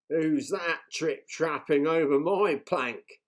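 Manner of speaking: pirate chief mocking the troll; jeering. A narrator's reading. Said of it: "jeered Hank Chief".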